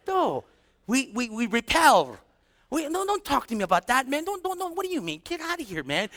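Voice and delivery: mock-alarmed refusal to listen; dismissive brush-off. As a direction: wave the person away, words tumbling out. Acts unbeliever brushing off gospel.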